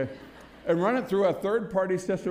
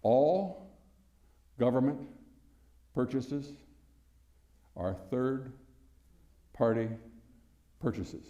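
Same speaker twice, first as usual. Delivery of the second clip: a weighty pause after ALL, GOVERNMENT, PURCHASES and THIRD-PARTY; pauses for emphasis; 3 semitones lower, much slower than usual. Real, not acted. Speaker making a profound point to the audience, pausing for emphasis. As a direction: set out "All government purchases are third-party purchases" piece by piece, sinking in pitch and level. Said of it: "supposed to pause for emphasis".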